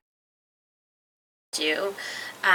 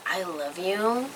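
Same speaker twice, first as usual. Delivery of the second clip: softer, slow, lower reluctant tone; regretful teacher; 4 semitones lower, 7 dB quieter, slower than usual. Retold or imitated. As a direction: break it to her gently. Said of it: "and she's like".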